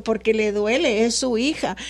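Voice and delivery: usual speaking voice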